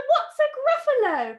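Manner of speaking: high, loud astonished question; baffled snake. Acted. Snake asks mouse about the Gruffalo, baffled. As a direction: blurt it out in high-pitched bafflement.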